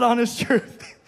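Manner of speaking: usual speaking voice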